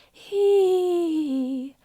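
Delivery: airy, slow breathy he; breathy onset. Shown as a demonstration. Vocal coach demonstrating a breathy onset. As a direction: let lots of air leak into he. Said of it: "If it were breathy".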